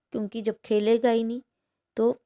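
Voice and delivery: normal talking voice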